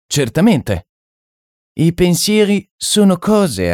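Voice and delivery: high-pitched mystic, stretching key phrases; French medium. Acted. French medium explaining that thoughts are things, high and assured.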